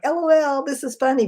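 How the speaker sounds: high mock-cheery voice with a sarcastic edge; sarcastic LOL. Texter faking amusement in a reply, high and sarcastic. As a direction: pretend it's funny while clearly not meaning it. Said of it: "there's a sarcastic tone to it".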